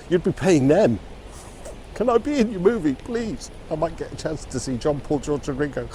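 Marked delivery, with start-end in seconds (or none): 1.9-5.9 high, breathless fan begging to join; pleading starstruck fan. Pretend. To the Beatles, a fan begs for a part, high and pleading. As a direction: beg like a starstruck teen fan.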